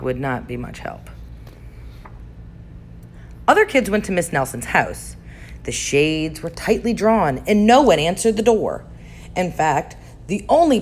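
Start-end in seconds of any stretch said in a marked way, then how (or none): none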